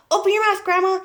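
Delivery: high, loud urgent order; urgent cry. A narrator's reading. Boy with water jug at smoking grandma, urgent cry. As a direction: cry it out quickly to save her. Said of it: "he cried".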